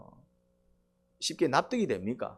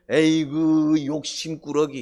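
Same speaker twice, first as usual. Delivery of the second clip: slow, drawn-out tut-tut at a greedy man; mock-scolding sigh, slow and louder; mock chiding; 6 dB louder, much slower than usual. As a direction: drag it out like a weary scold. Jesus voiced chiding the younger brother.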